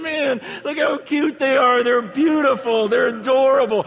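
put-on high, loud gushing over cute kids; mock the crowd cooing at the children; imitating the audience gushing over the kids. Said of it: "you guys go nuts".

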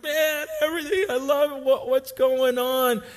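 high, lost cry; David distraught. Retold or imitated. David after losing his family, distraught. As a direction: cry out everything I love.

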